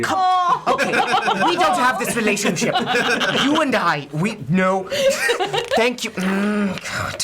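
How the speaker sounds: high, loud, flustered prissy objection; prissy, noble protest. Acted. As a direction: squirm out of an unwanted hug, laughing through it. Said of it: "You've never seemed more prissy and noble".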